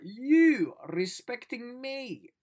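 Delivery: high, loud, very slow stretched question; gassed disbelief. Retold or imitated. Vlogger reliving his excitement at being respected. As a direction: draw it out in giddy awe. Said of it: "get gassed and excited".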